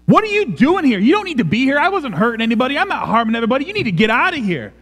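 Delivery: high, frantic pushing away; stiff-armed repulsion. Acted. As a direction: plead and protest, driving them away in a rising pitch. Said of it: "This is a stiff-armed out".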